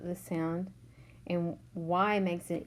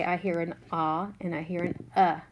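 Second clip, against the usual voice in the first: normal talking voice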